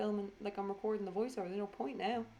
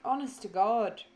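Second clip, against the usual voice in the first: bored; 2 semitones higher, 8 dB louder than usual. Real, not acted. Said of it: "I'm bored".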